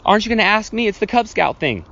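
high, loud acted question; acting out a puzzled passer-by; imitated incredulous prompting. Imitating passer-by prompting the hesitant boy.

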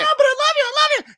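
very high-pitched mock pleading; pleading gushing Anna. Younger sister begs the one sending her away, high squealed pleading.

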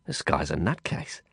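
low, quiet aside to a companion; muttered. A narrator's reading. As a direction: mutter it under your breath. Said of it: "I muttered to Prabhakar".

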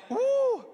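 high shouted woo; loud whoop. Real, not acted. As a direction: let out a joyful whoop. Preacher whoops to congregation.

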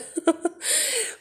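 high, soft giggle; a quick high giggle at herself; laughing at her own joke, a soft high giggle. Real, not acted. Laughing at her mock outrage.

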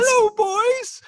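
high falsetto granny greeting; shrill put-on old lady voice; Mrs Doubtfire voice. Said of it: "doing, like, a Missus Doubtfire here".

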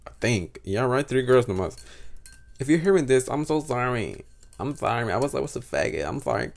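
ordinary talk

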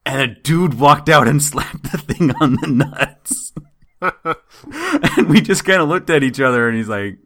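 laughing. Real, not acted. Laughing while retelling statue slap.

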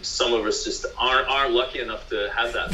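mock American pep talk to partner; American accent. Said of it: "just do whatever you want in an American accent".

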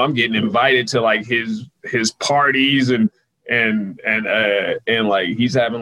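usual speaking voice

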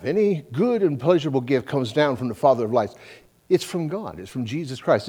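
ordinary delivery

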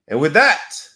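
loud lead-in then slow drawn-out farewell; show sign-off. Acted.

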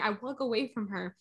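ordinary talk

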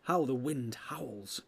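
slow, subdued wondering; drawn-out, unforced observation; slow musing. Acted. Hobbit remarks on the wind.

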